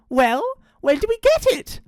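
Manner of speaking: high, excited joey voice; eager child. Acted. Impatient joey asks.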